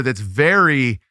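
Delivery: normal talking voice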